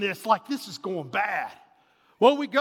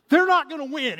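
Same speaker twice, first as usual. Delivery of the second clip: determined; 6 semitones higher, 7 dB louder than usual. Retold or imitated. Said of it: "I was like".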